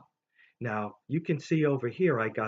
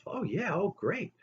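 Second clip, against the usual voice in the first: put-on delighted kid; 8 semitones higher than usual. Kid's delighted reply.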